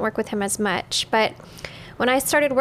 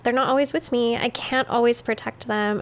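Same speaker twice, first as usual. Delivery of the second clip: normal talking voice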